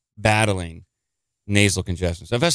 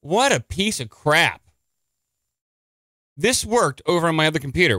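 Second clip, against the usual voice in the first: exasperated; 6 semitones higher than usual. Real, not acted. Frustrated at the video site.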